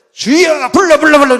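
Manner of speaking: high, very loud chant of a faith healer; mock revival shout. Preacher mimics the healer at the revival, high loud shouting. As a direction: cry it out like a frenzied revivalist.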